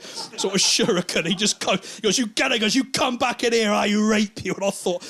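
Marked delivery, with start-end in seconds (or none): 2.2-2.5 angry threat. Acted. Counter man threatens the thrower. 2.7-4.5 high-pitched mock-furious yelling; angry threat. Chip shop man threatening a customer, angry.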